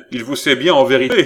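exclaiming in indignation; indignant exclamation. A narrator's reading. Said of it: "s'écria Philippe".

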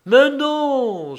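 high, loud exasperated cry; exclaimed no. A narrator's reading. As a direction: cut her off sharply. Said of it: "s'écria Richaud".

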